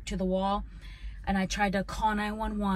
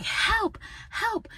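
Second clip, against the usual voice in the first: screaming for help; 13 semitones higher than usual. Retold or imitated. Child in the window shrieking up to the police across the street. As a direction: shriek it out to the cops. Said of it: "And I'm like".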